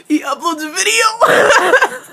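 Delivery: slow words through a laughing fit. Real, not acted. Fan imagining Alec's YouTube channel, cracking up laughing. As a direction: laugh while you say it.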